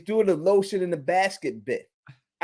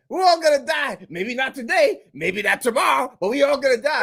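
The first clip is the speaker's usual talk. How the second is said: raised, shouty doomsday imitation; mocking Reggie, higher and shouting; mock doom; 5 semitones higher than usual. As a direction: preach the doom in mockery. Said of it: "he's like".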